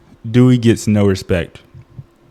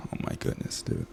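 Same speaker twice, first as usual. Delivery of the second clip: voiceless whispered oh my goodness; whispered exasperation; 20 dB quieter than usual. Real, not acted. Ranting about Dewey, whispered exasperation. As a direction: breathe it out, fed up.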